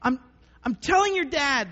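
high, slow mock-exasperated parent; hammed-up parent at the end of his rope; exasperated parent rant. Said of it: "I'll be like".

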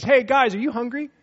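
hollering a friendly offer; calling out. Retold or imitated. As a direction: call across the riverbank, friendly. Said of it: "he calls out".

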